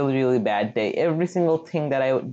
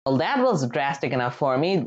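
usual speaking voice

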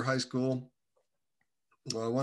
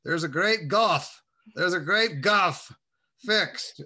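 put-on higher, louder and slow, a Southern old man preaching; Mister Rabb's voice; 6 semitones higher, 6 dB louder, slower than usual. Old man explaining scripture to a young visitor, slow and booming. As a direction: lean into each word like an old Southern preacher. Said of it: "he's speaking Mister Rabb language".

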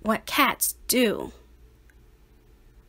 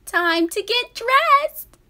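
high, slow sing-song call; mom's call; 12 semitones higher, 4 dB louder than usual. A narrator's reading. Mom calling her son to dress, high and slow. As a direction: draw it out to hurry him along. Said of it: "said his mom".

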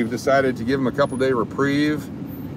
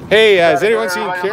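calling out; 7 semitones higher, 4 dB louder than usual. Shown as a demonstration. Calling out from car.

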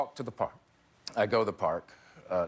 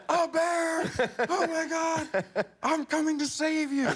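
very high, comic shriek of alarm; mock panicked wife; slower than usual. Wife hears husband is running from a bear, mock panic. Wife's alarm voiced in the story.

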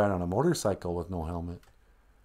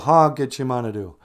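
normal talking voice

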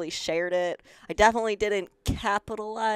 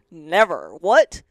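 retold outrage; 12 dB louder, slower than usual. Outraged at the outfit.

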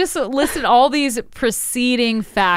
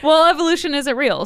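sarcastic; 7 semitones higher, 4 dB louder, faster than usual. Pretend. Sarcastic closing joke.

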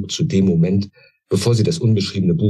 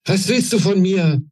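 still shouting; 12 semitones higher than usual. A narrator's reading. Queen repeating her question to the old woman, still shouting. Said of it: "wiederholte die Königin nur unwesentlich leiser".

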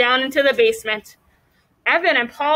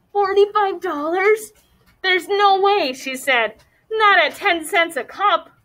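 higher, incredulous protest; stunned disbelief; 6 semitones higher than usual. Acted. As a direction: refuse to believe the sum. Jessie's mouth went slack.

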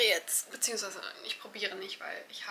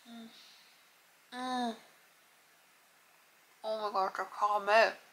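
biting and chewing; 3 dB louder than usual. Real, not acted. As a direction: mouth full of chocolate. Seen 2: Bar at open mouth biting, then chewing with full mouth.

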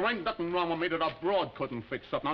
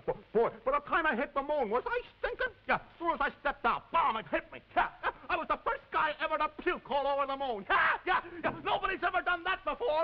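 very high, hysterical crowing; manic boasting; 12 semitones higher than usual. Acted. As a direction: brag wildly, voice soaring out of control. Drunken moon boast getting out of hand.

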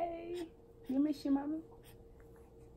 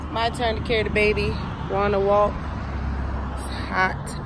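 ordinary talk

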